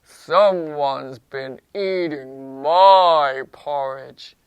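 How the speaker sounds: slow, loud, low papa bear; big low bear voice, dragging MY; low-voiced Daddy Bear. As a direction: boom it out slowly, down low. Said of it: "in his low voice".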